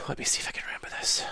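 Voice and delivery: soft, quick whisper to herself; sighed whisper while thinking; whispered aside. Real, not acted. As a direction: mutter it under the breath.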